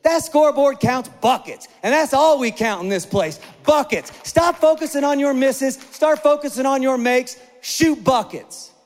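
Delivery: a coach firing up a discouraged player, slowing on the last words; coach's pep talk. Retold or imitated. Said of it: "He goes".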